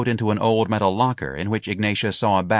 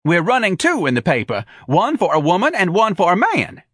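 high, loud, eager figure voice; enthusiastic manager; 10 semitones higher, 5 dB louder than usual. A narrator's reading. Office manager explaining ads to an applicant, enthusiastic.

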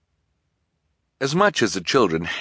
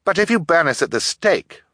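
high, raised interjection; anxious, pitched-up warning; urgent girl; 10 semitones higher, 4 dB louder than usual. A narrator's reading. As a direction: jump in to reason with the captor. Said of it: "Violet said quickly".

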